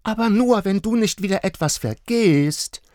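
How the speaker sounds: put-on high, loud admonishing child. A narrator's reading. Little girl warning her father, high and firm. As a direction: warn him like a bossy little girl. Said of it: "mahnte Marla".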